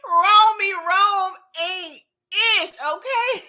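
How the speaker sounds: slow, cracking high laugh through the words; laughing outburst. Real, not acted. Vlogger cracks up over Romy Rome, laughing. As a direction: lose it laughing.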